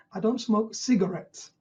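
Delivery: slow, weight landing on "cigarettes"; stress on cigarettes. Shown as a demonstration. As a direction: pick out "cigarettes" as the key word. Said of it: "they've emphasized the word cigarettes".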